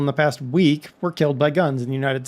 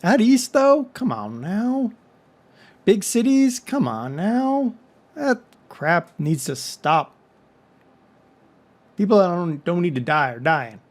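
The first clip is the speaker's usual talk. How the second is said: put-on drawled Southern accent, pitched up at phrase starts; silly Southern accent; 3 semitones higher than usual. Vlogger scolding big cities out east, silly Southern accent. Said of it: "use a silly Southern accent".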